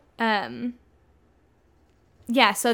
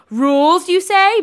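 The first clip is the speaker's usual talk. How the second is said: high, loud mock-puzzled query; confused; 9 semitones higher, 8 dB louder, slower than usual. Bella questions the vampire rules, confused. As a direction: exclaim it in exaggerated confusion. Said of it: "Bella was very confused".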